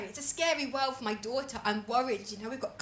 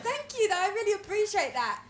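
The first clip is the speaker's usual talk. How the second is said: high polite; 6 semitones higher than usual. Retold or imitated. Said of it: "And I was like".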